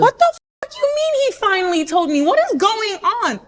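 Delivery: high, exploding disbelief; outraged outburst. Retold or imitated. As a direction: explode at the friend's admission. Explodes at friend's admission.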